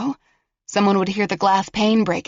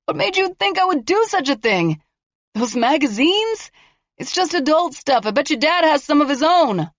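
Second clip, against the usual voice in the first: higher, mocking taunt; taunting killer; 9 semitones higher than usual. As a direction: sneer at her bluff. Killer wheedling behind door.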